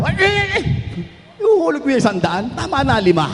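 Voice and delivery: hammed-up mom catching her kid; mock alarmed mother. Mother grabbing back the hundred-peso offering, high startled cries.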